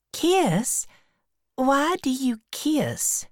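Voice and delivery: put-on very high girlish questioning; high, puzzled little-girl voice; Becky, puzzled schoolgirl. A narrator's reading. Becky asking Tom why people kiss, high puzzled voice.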